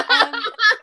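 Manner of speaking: breaking into high, loud laughter; words swept up in a real laugh. Real, not acted. Laughing at obscure book.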